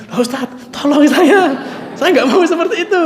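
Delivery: high pleading, imitating her crying; mock the weeping woman begging for help; imitated tearful plea. Defendant begging the ustaz to save her, imitated tearful pleading.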